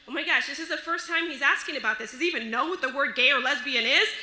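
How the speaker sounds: fast, rising mock panic; panicked thinking. Parent unsure what to tell the boy, mock panic. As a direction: race through the worried questions, tumbling over each other. Panicked thoughts about boy's question.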